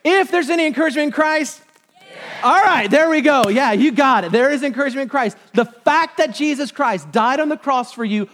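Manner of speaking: high, loud pulpit rallying; rousing preaching. Real, not acted. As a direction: fire up the congregation for a bigger response. Rallies congregation at full force.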